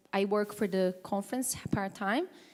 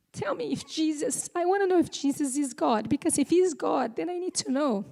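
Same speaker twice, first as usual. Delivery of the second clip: high, tearful, voice catching on the words; pushing through tears while retelling his words; voice breaking with emotion; 7 semitones higher, 4 dB louder than usual. Real, not acted. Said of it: "I get a bit emotional".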